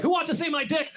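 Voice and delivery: high, rowdy party yell; wild drunk shout. Retold or imitated. Rowdy outburst after downing a wheatgrass shot, party yell. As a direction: bellow it like a wasted frat guy.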